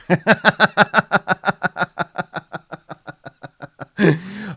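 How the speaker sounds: loud high burst of real laughter. Real, not acted. Laughing at his own joke about Grandpa Touchy, loud high laughter.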